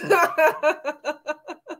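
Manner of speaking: high, long run of laughter; delighted laugh. Real, not acted. Guest just welcomed by the host, delighted laughter. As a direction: laugh freely at a high pitch.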